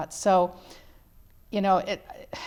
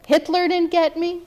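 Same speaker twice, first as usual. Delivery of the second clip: 1 mother's defiant words; 9 semitones higher, 7 dB louder than usual. Retold or imitated. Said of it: "and she said".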